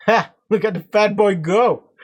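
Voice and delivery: high, loud cackling jeer; barking laugh. A narrator's reading. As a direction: bark a laugh and crow at him. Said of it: "barked out a single laugh".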